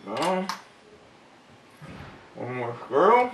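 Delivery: talking around a flashlight held in teeth; flashlight in mouth. Real, not acted. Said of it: "let me take this out of my mouth".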